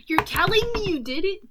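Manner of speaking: high, loud disbelieving question; incredulous. Acted. Talk show host challenges Joker's confession, incredulous. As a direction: pitch it up in mock shock. Host's line redone, incredulous.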